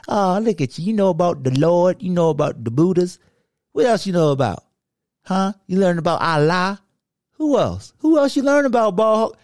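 high, playful ribbing of a know-it-all; mock teasing. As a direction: needle him with sing-song mockery. Teasing co-host about religion degree.

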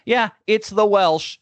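high, slow, playful "Yeah, it's the Welsh"; proud confirmation. Retold or imitated. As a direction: own the nickname with mock pride. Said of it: "I was just like".

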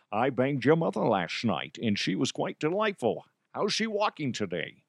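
comic Connery voice, proper and cheeky; Connery DeHuff. Acted. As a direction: play the smug gentleman. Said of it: "Connery DeHuff".

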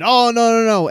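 high, loud imitated groan of dismay; hammed-up "oh no" of a friend caught out; mock dismayed realization. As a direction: act out the penny dropping. Friend realizing his mistake.

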